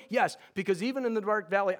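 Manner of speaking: ordinary talk, nothing marked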